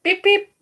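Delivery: very high, loud squeaky beep beep; mock beeping. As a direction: squeak it out like a toy horn. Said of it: "Be like".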